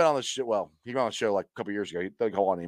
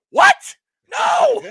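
much higher, louder shouted denial; horrified no; 11 dB louder than usual. Retold or imitated. Fan retelling his panic watching the game, shouted no. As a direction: scream it like your team just ruined the plan. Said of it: "I'm like".

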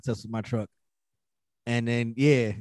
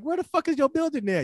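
confused, pitched up; high squeaky confusion; high mock confusion. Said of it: "I'm like".

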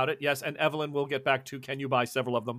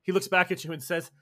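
ordinary delivery

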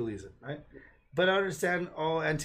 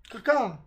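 mock crow call; 5 semitones higher, 3 dB louder than usual. Imitated bird call.

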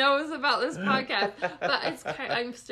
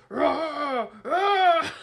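loud, higher-pitched mock roar; mock macho roar; 4 semitones higher, 8 dB louder than usual. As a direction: roar like a would-be muscleman. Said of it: "and he's like".

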